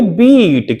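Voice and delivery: "बीट" with the ee drawn out unhurriedly; long ee. Shown as a demonstration. Teacher corrects pupils, "बीट" slow with long ee. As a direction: stretch the long vowel in "बीट". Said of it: "आराम से बोलेंगे".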